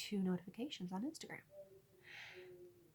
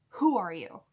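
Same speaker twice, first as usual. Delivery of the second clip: loud, falling "Who are you"; forceful suspicious "Who are you"; demanding challenge; 11 dB louder than usual. Retold or imitated. Retold suspicious challenge to the texter.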